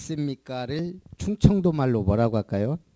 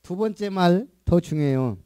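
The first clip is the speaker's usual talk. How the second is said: ordinary talk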